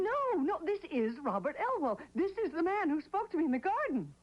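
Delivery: high, urgent, pleading it's him; frantic insistence. Acted. Widow insisting to the lawyer she saw him, frantic and high. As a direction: press him to believe you, rising.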